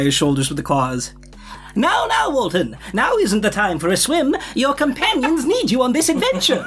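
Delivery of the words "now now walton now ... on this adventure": high, bright little creature voice; squeaky, chipper cartoon sidekick; cheery crab voice. Crab companion speaks to Walt.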